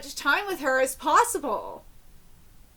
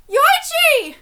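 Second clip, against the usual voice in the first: high, loud call of a name; anguished cry, pitch leaping up; 10 semitones higher, 7 dB louder than usual. Acted. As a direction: shout the name in distress. Name cried out while reading manga.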